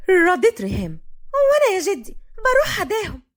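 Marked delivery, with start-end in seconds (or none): 1.3-3.2 much higher-pitched plea; insistent young woman. A narrator's reading. Riham asks grandfather to go too, insistent. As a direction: pipe up to be included.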